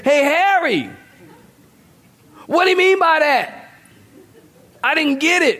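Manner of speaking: high, pleading woman's voice; whispering across to her husband. Retold or imitated. Wife asking husband in synagogue.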